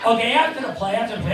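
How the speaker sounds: ordinary talk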